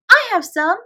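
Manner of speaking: high, loud squeal; little shriek. A narrator's reading. Lottie shrieks to Sarah that she has crumbs, high and loud. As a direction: squeak it out like an excited child. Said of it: "came in a little shriek".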